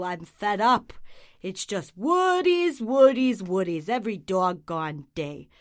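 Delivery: loud, fed-up cartoon dog; grumpy dog. Neglected dog grumbling about his owner, loud and fed up. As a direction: complain like a neglected pet dog.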